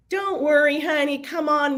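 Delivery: put-on a high, half-hearted Southern accent; attempted Southern drawl. Southern agent soothing the late speaker, mock Southern drawl. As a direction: try a sweet Southern lady, not quite landing it. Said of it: "I can't do the Southern drawl".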